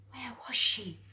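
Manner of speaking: frightened hushed whisper; whispered worry. A narrator's reading. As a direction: breathe the question in fear. Anxious narration, searching.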